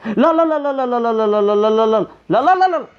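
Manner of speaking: high, loud frantic repeated no; panicked screaming. Real, not acted. Gamer panicking as the monster chases, screaming no. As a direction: shriek no over and over in panic.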